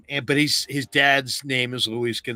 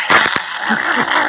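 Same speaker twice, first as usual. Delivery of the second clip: high, loud, breathless yelp; mock dog yelp; 12 semitones higher, 9 dB louder than usual. Imitating a dog in the Pink Panther, loud yelp. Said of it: "and the dog goes".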